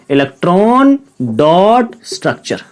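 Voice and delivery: slow, deliberate naming of the term; slow drilled term. Shown as a demonstration. Teacher drills the term to the class, slow and deliberate. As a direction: say each word slowly so students repeat. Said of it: "क्या कहलाता है बच्चों?".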